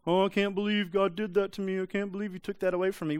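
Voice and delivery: mock whining. Preacher imitating churchgoers who whine about sanctification, mock whiny. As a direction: put on a complaining whine. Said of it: "Some of you want to whine".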